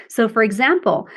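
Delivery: ordinary talk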